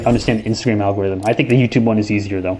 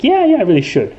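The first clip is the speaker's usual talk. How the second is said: fake agreement; 10 semitones higher than usual. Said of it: "I was like".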